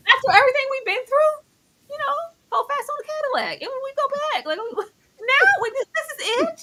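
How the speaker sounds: high, hammed-up farewell speech; a squeaky, melodramatic goodbye; mock farewell. Voicing a character at goodbye.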